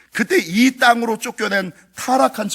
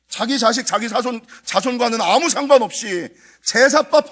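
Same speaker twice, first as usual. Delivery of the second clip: fervent preaching; 5 semitones higher, 4 dB louder than usual. Real, not acted. Preaching at full force.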